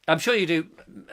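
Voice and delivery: ordinary talk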